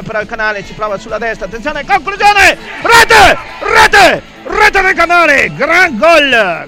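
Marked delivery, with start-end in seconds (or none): 1.4-6.6 high, loud goal scream; breathless italian goal commentary. Real, not acted. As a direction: shout the goal out at full voice. Goal call in live commentary.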